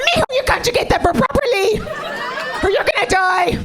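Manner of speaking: high, fast shouted comic scolding; furious mother. Acted. Mother to drowning son about his verb, furious high yelling. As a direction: yell the order as a hammed-up threat.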